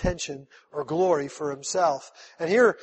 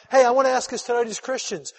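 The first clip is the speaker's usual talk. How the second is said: ordinary delivery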